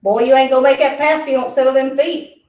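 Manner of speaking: loud country Southern telling-off; rough Southern. Pretend. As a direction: scold him in roughed-up Southern, loudly. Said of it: "rough it up a little".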